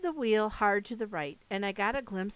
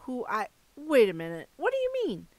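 baffled alarm; 3 semitones higher, 3 dB louder than usual. Acted. Percy baffled by a revelation.

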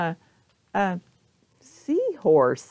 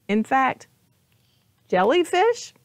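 usual speaking voice

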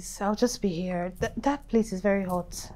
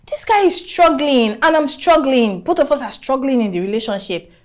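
louder, rising complaint; exasperated rant; 5 semitones higher, 9 dB louder than usual. Acted. Woman rants to friend about her broke boyfriend, loud and exasperated. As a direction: vent it loud and fed up.

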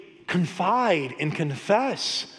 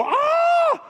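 sudden high mock toddler shriek, much higher; imitated toddler scream; 4 dB louder than usual. Dad imitates his toddler shrieking when the song stops, high mock shriek. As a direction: yell out one sharp high "Ah!" like the toddler. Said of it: "she go".

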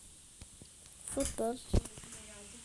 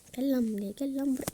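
ordinary talk

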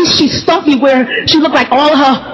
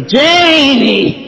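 Grandma calling her. Retold or imitated. Grandma greeting grandchild at daily visit, drawn-out call. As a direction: call out to a grandchild, vowel held long. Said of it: "I heard her say".

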